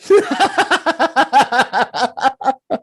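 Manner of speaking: high, loud burst of real laughter; loud laughing fit. Real, not acted. Co-host reacts to the bartender's Hitler punchline, laughing hard. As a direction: crack up helplessly, long and high.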